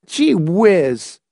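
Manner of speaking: put-on high boyish dismay; despairing complaint. A narrator's reading. Boy scolded by his mother, despairing complaint. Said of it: "David complained, despairing".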